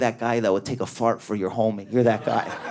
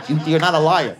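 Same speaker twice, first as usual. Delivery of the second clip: ordinary talk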